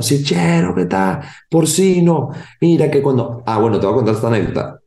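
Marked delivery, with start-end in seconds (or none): 0.0-3.4 put-on kids greeting. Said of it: "todo el mundo".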